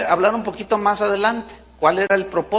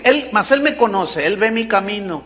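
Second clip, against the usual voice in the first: usual speaking voice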